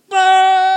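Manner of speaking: long, high, very loud scream; running scream. Retold or imitated. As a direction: scream all the way downhill. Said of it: "screaming through the".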